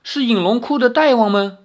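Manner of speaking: high, halting, fearful question; trembling voice. A narrator's reading. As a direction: ask timidly in a high, trembling old man's voice. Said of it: "颤声说". (颤声怯怯地问)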